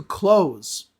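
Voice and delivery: clothes said as "kloze"; clothes with TH dropped, ending in Z; close for clothes. Shown as a demonstration. Teacher slipping close into a sentence, clothes as "kloze". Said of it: "I said the word clothes".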